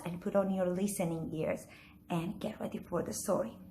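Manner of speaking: ordinary delivery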